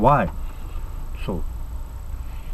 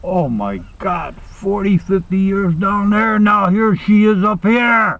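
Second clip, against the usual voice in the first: slow, drawn-out exasperation, building; mock dismay; 2 semitones higher, slower than usual. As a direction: stretch every word, rising as it goes. Husband dismayed his wife has arrived.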